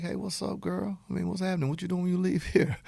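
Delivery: flirty suitor. Acted. Suitor chatting up someone they are attracted to, lightly flirty. Acting out flirting in dating analogy.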